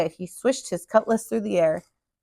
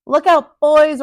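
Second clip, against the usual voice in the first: loud, higher commanding warning; ordered warning; 5 semitones higher, 8 dB louder, slower than usual. A narrator's reading. Captain orders his men to beware, loud command. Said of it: "ordered the captain".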